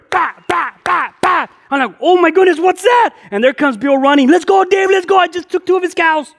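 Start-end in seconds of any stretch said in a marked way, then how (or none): 0.1-1.5 high, punchy gunshot noises; vocal bang sounds, evenly spaced; imitated gunshots. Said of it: "and then I hear". 2.0-3.1 high-pitched shouted alarm; acted shock at sudden gunfire; startled. Startled by gunshots in story. 4.3-6.3 high-pitched, urgent getaway shout; acted breakneck panic, yelling to flee; hammed-up excited holler; frantic yelling. Friend running, yelling to flee.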